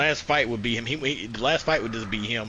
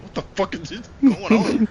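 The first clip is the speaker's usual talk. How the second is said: high, slow, loud disbelief; voicing a baffled soldier; 8 semitones higher, 6 dB louder than usual. Soldier in the game asks what is going on, stunned. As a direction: stretch out the outraged question. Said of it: "He's like".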